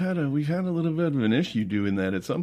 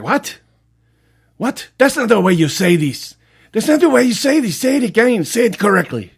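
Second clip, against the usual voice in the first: shrill high scolding, louder; indignant teacher; 12 semitones higher, 7 dB louder than usual. Retold or imitated. Spanish teacher scolding the pupil, high and loud. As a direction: demand he say it right.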